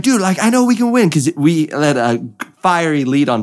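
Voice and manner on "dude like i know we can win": high-pitched eager insistence; excited, amped up. Retold or imitated. Driver sure of a win urging his crew on, excited. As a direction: imitate a driver fired up about winning. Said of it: "He's just, like, excited".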